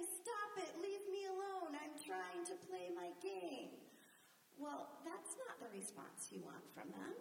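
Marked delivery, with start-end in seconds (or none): 0.0-3.8 high mock snap thrown back by a kid; the child copying the parent's brush-off; snapping child. Mother imitating a child snapping back when asked to clean up.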